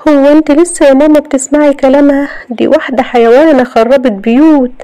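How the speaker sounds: high, loud angry protest; indignant outburst. A narrator's reading. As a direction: lash out at the troublemaker. Family quarrel, accusing a woman.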